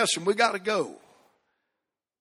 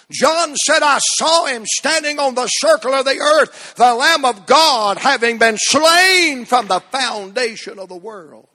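reading the verse higher, slowing at the end; fervent scripture reading; 6 semitones higher, 6 dB louder than usual. Acted. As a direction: proclaim the verse to the congregation. Quoting scripture loudly.

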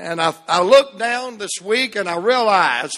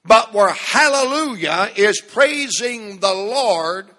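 usual speaking voice

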